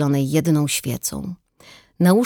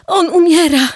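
high, loud urgent cry; excited cry; 14 semitones higher, 10 dB louder than usual. A narrator's reading. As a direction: call out in alarm. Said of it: "zawołała z przejęciem". (wzburzony okrzyk)

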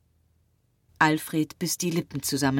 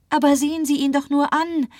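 much higher, louder woman's voice; Marie pleading; 11 semitones higher, 6 dB louder, faster than usual. A narrator's reading. Marie urges the doctor to look, pleading high. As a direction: appeal quickly in a high, urgent voice.

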